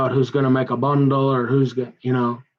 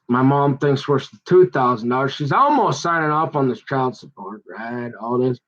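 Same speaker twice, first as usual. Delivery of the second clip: ordinary talk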